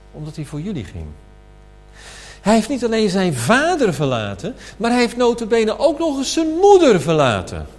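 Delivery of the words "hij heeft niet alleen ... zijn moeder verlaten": emphatic preaching. Acted. Preacher's climax on leaving mother.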